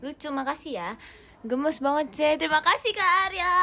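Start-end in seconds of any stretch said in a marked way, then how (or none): 2.4-3.5 high, loud thank-you shout; teriak-teriak. Real, not acted. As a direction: shriek the thanks to a gift-giver. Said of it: "Kita teriak-teriak". (teriakan terima kasih melengking)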